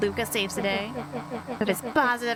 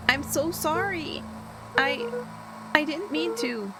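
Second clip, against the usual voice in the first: guilt-stricken; 6 semitones higher than usual. Acted. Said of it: "overwhelmed by guilt".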